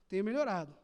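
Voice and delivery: usual speaking voice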